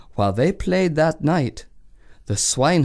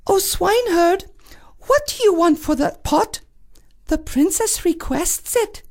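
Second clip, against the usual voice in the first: high lady in waiting. Acted.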